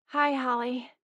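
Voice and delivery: small, miserable hello. A narrator's reading. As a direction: greet weakly, utterly miserable. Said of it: "A miserable voice replied".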